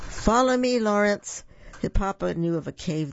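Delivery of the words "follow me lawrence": higher, louder call through the storm. Acted. Papa calling Lawrence to the cave in the rain, loud higher call. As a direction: call him to follow over the rain.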